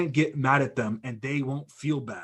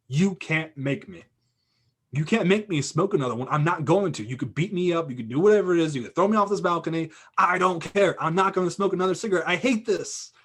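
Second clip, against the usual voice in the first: defiant outburst; 5 semitones higher, 5 dB louder than usual. Retold or imitated. Guy finally refuses, had enough.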